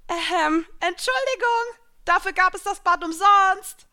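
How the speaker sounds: very high, loud shout to a crowd; calling down. A narrator's reading. Heroine to drenched audience below, calling out loudly. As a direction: holler it down to people far below. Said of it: "rief ich nach unten".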